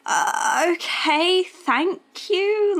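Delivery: high, halting polite reply; hesitant, awkward thanks. Retold or imitated. Retold awkward thanks for smelly coat.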